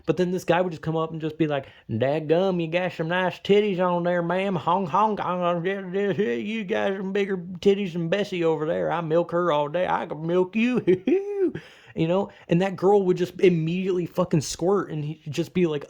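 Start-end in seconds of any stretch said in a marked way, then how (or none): 1.9-11.5 hillbilly voice. Retold or imitated. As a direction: play a leering Southern hillbilly, honking like a goose. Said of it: "rotted hillbillies".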